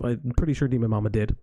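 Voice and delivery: ordinary delivery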